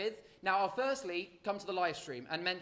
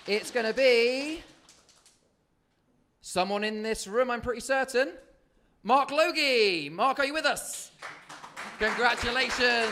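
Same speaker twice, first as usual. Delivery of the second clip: hyped giveaway announcement; 4 semitones higher, 8 dB louder than usual. Real, not acted. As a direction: draw out the name, then cheer. Second winner reveal.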